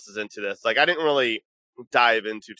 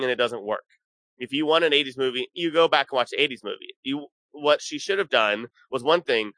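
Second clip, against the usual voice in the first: ordinary delivery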